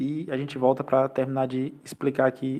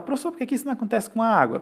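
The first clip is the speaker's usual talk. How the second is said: lightly voiced student, higher and quicker; questioning student; 8 semitones higher, faster than usual. Student asks the professor about water, lightly imitated curious questioning. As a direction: put a quick, higher-pitched question to the teacher. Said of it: "Aí alguém fala".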